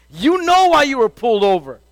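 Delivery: normal talking voice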